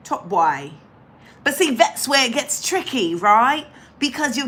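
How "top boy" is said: put-on Cockney with a bit of London mix; Cockney accent. Shown as a demonstration. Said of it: "now I'm doing a Cockney, a little bit of a Cockney kind of accent".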